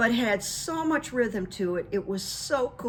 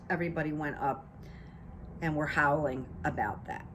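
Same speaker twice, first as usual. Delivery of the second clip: ordinary talk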